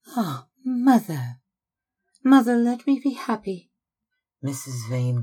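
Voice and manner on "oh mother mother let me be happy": much higher and louder, begging; pleading cry. Acted. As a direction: cry out to her mother, pleading. Daughter pleads with her mother.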